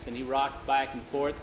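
usual speaking voice

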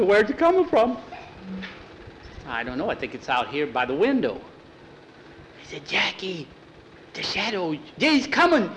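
put-on a kid sure the shadow is coming; frightened child. Scared boy hears scratching.

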